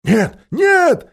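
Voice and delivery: high, loud frightened cry; panicked shout of many; loud high crowd cry. Acted. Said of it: "закричали почти все".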